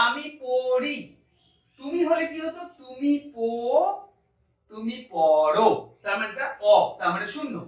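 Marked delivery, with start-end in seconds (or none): none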